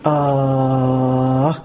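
deep drawn-out ahh of awe; savoring sigh; long low sigh of wonder. Real, not acted. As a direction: let out a long low moan of delight. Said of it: "شوف الجمال".

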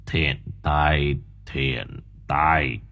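low, soft drawn-out blessing; solemn monk murmur. A narrator's reading. Old monk blesses the fainted boy, low and soft. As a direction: murmur it like a prayer.

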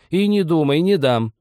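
high, loud scolding; lively refusal. A narrator's reading. Old woman denies husband tobacco, loud high refusal. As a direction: forbid it outright. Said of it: "живо возразила старуха".

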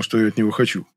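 usual speaking voice

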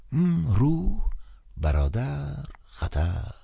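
put-on cold, high old man listing words; old priest coldly echoing the caller. A narrator's reading. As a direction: repeat each word with disdain. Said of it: "با سردی خاصی گفت".

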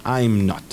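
I'm not with the vowels of I am joined into one; Spanish teacher contracting I am not to I'm not; contracted I'm. Shown as a demonstration. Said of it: "podemos juntar las vocales".

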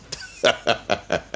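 a breathy, scornful chuckle; short mocking laugh. Minister laughing at the prisoner's soul.

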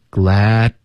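"glad" cut off with tongue tip between teeth; dropped D. Shown as a demonstration. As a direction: glad with a silent, stopped D. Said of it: "省略的方式最好咬舌尖".

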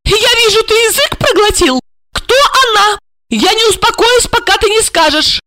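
very high-pitched raging wife; infuriated. A narrator's reading. Wife provoked by husband's silence, infuriated. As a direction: press him furiously in a high voice. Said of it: "ещё больше взбесило Ольгу".

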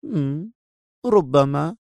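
noncommittal lilt with a teasing hum; provocative. A narrator's reading. Captive woman shrugging at her captor, provocatively casual. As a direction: hum first, then toss out the word. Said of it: "بذلك الأسلوب المستفز وهي تقول".

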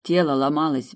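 ordinary delivery, nothing marked

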